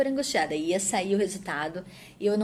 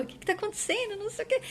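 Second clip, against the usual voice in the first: much higher, a mock confused demand; imitating husband's quarrel; 14 semitones higher, much faster than usual. Husband snatching phone from cousin, mock angry confusion. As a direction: play the husband grabbing the phone demanding answers. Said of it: "começou a brigar com a pessoa".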